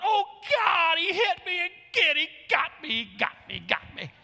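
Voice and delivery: imitating a drunk crying out, high and loud, then falling away; huge mock wail that winds down; drunken yell. Drunk Raul interrupting the sermon from back row, yelling. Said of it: "he'd yell out loud".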